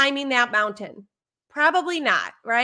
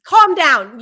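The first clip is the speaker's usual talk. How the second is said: harsh command; 2 semitones higher, 10 dB louder than usual. Shown as a demonstration. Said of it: "But when you say".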